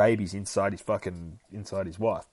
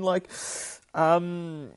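ordinary delivery, nothing marked

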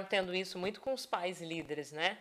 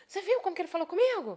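higher, fast, indignant employee complaining; offended employee; 9 semitones higher, 3 dB louder, faster than usual. Retold or imitated. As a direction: mimic a hurt employee gossiping about the boss. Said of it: "o funcionário já vai lá e fala assim". (imitando funcionário ofendido, agudo e rápido)

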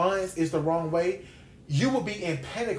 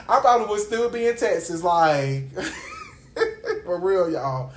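laughing. Real, not acted. Preacher owns up to the congregation, laughing. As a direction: crack up while insisting on it.

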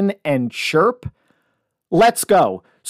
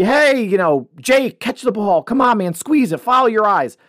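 put-on raised, higher coaching bark at a kid; coach yelling at player; 4 semitones higher than usual. Youth football coach calling to a player, mock yell. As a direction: push the player to catch the ball, half-yelled. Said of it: "kids are gonna get yelled at".

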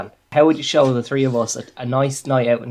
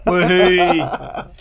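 normal talking voice

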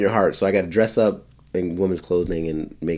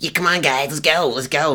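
a lightly put-on, higher goofy version of his own voice; playful higher mimicry of his friend's teasing; mocking his own voice; 7 semitones higher than usual. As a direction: imitate a friend mocking how you talk, pitched up. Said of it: "he, like, does my voice".

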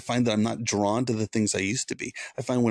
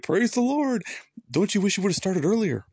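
high, bright mock praise with a teasing edge; mock exclamation; 11 semitones higher than usual. Much higher, playful mock praise. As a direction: congregant thanks the pastor, he teases back in mock praise. Mocking reply to grateful congregant.

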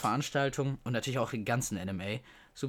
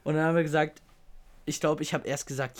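normal talking voice

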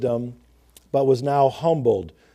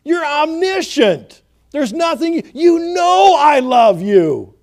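much higher and louder, slowing to a pleading cry; emphatic desperate plea; 12 semitones higher, 10 dB louder than usual. Retold or imitated. Peter pleading with the Lord, loud, high and desperate. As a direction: cry out to the Lord, begging to be believed. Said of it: "that's the kind of emphasis he has here".

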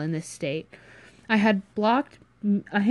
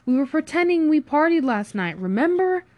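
high, louder, indignant reminder; exasperated retort; 8 semitones higher, 5 dB louder than usual. Acted. Friend defends the cover story, exasperated. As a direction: push the pitch up in exasperation.

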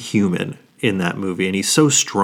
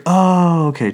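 high, bright oh of realization; sudden recognition; 9 semitones higher, 4 dB louder, slower than usual. Retold or imitated. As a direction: realize it all at once. Students recognizing Woody.

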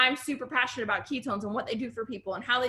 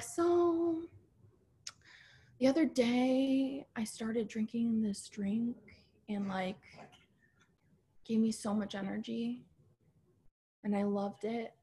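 softer, slow, droning sales pitch with no energy; mocking a dull seller; 7 dB quieter, slower than usual. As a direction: drag it out like a boring live video nobody wants to watch. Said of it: "she went on like that for, like, five freaking minutes".

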